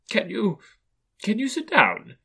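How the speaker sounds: high, hesitant question; doubtful. A narrator's reading. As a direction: stumble into the question, pausing to restart. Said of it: "looking doubtfully at him".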